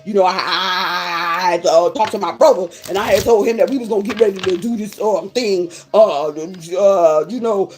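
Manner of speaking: a hint of a fumbling guy making excuses; hesitant brother. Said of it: "so what you doing for yourself, brother".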